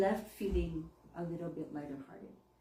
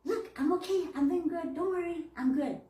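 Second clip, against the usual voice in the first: higher cheerful little-bird voice; bird voice reassuring; 7 semitones higher, 4 dB louder than usual. Pretend. Bird telling its mommy not to worry, high and cheerful. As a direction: sound like a pet bird comforting its owner.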